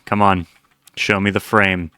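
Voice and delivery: ordinary talk, nothing marked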